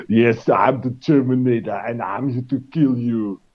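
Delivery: a lightly done robotic Austrian Terminator voice, heavy and even; deadpan, mock-menacing Arnold delivery; Schwarzenegger Terminator impression. German guest mocking Terminator's English voice, mild Schwarzenegger impression. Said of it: "how the fuck are Americans taking him seriously talking like this".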